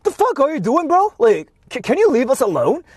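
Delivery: sneering high parody of an annoyed tourist; mocking rude tourist. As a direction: play the bro as an obnoxious whiner. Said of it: "they were like".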